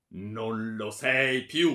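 slow, loud, weighty disowning; cold-blooded fury. A narrator's reading. Baron disowning his daughter, furious. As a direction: draw out each word with loud menace.

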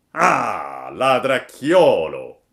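loud, slow furious exclamation; angry farmer. A narrator's reading. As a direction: bellow at the little thief. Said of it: "disse il contadino incollerito".

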